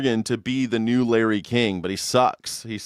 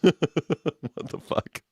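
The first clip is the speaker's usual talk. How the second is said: normal talking voice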